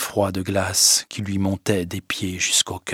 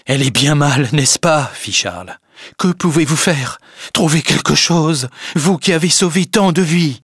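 higher, louder, pleading; desperate plea; 6 semitones higher, 4 dB louder than usual. Acted. Charles imploring the doctor to find a cure, high and loud. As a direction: beg the doctor to save her.

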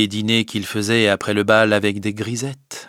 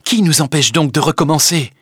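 higher, louder lover's plea; impassioned exclamation; 6 semitones higher, 5 dB louder than usual. A narrator's reading. Léon urging Emma to begin their love anew, impassioned exclamation. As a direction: exclaim it with urgency. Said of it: "s'écria Léon".

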